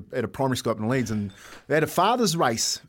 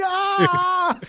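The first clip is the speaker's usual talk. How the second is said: high, drawn-out mock scream; high-pitched comic "aah"; imitated scream; 4 dB louder than usual. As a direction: mimic a man screaming in pain, half laughing. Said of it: "And he's screaming".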